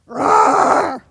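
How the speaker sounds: loud, growled tiger roar. Shown as a demonstration. Showing the audience how tigers roar, loud. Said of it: "And now we're gonna be tigers".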